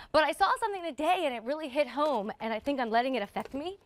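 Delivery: ordinary delivery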